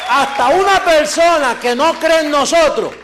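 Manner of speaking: preacher rousing the faithful, loud and fervent; full-force preaching. Acted. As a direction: drive it home from the pulpit.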